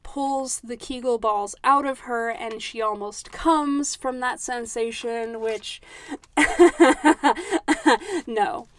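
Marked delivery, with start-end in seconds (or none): 5.8-8.2 high, loud disbelieving laugh; incredulous laugh. Real, not acted. Host laughs in disbelief at the book's scene. Reacting to the scene she read.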